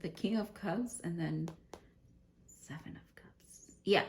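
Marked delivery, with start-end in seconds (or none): 2.5-3.8 whisper. Real, not acted. Card named in a hush.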